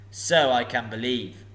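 a huge dragon toying with a thief; booming sly dragon; menacing dragon. Acted. Dragon speaks.

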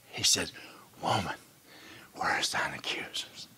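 very soft, low whisper; hushed retelling. Acted. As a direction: draw them in with a whisper. Jesus scene retold hushed.

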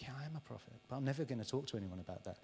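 soft, fast confiding; hushed secret. Acted. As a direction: keep it to yourself, quietly. Secretive prophet voice.

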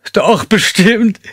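very high, slow whimper. A narrator's reading. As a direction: whimper it out in terror. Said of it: "wimmerte sie".

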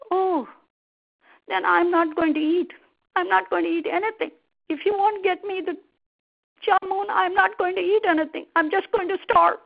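high, insistent threat from a wife; sulking crocodile wife. Acted. Wife threatens her husband with starving, stubborn and sulky. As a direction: dig in stubbornly, pitched high.

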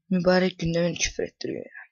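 ordinary talk

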